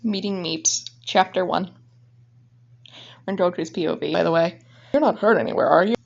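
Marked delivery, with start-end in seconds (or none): none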